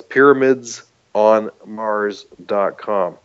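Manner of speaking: "pyramids on mars dot com" said slowly, each word distinct; slow and clear. Shown as a demonstration. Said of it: "So that's".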